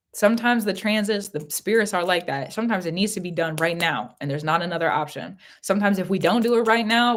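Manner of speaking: ordinary talk, nothing marked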